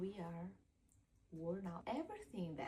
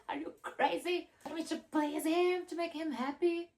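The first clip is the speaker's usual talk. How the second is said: much higher and louder, indignant disbelief; incredulous outburst; 8 semitones higher, 12 dB louder than usual. Retold or imitated. Speaker retelling her outraged reply to a friend's advice, incredulous shout.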